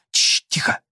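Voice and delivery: urgent hushed whisper. A narrator's reading. Boys hiding from strangers. (срочный шёпот)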